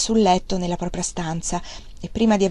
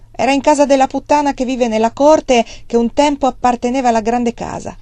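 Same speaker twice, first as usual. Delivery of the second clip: higher, louder, resentful retort; spiteful; 5 semitones higher, 5 dB louder than usual. A narrator's reading. Scared nephew answering the father with spite. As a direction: spit the answer back. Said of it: "rispose con astio e riluttanza".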